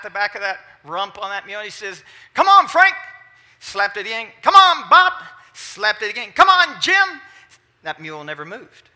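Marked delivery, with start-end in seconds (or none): 2.3-2.9 high, loud holler; calling to the mule. Retold or imitated. 4.4-5.1 high, loud holler; calling to the mule. Retold or imitated. 6.3-7.2 high, loud holler; calling to the mule. Retold or imitated.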